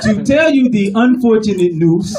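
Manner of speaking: put-on formal, measured African accent; dignified African accent. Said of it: "they so dignified and eloquent".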